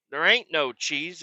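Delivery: usual speaking voice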